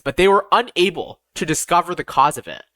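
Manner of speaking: plain reading voice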